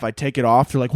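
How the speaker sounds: ordinary talk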